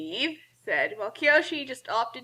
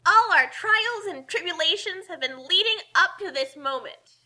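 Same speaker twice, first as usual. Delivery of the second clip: dramatic; 7 semitones higher than usual. A narrator's reading. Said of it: "Kasumi said dramatically".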